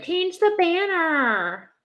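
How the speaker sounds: high, drawn-out teasing voice; teasing mock suggestion. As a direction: stretch it out high to tease. Teasing guess at friend's comment.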